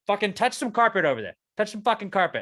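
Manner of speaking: high, loud angry outburst; losing it. Retold or imitated. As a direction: snap and yell at him. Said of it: "lost it on this guy".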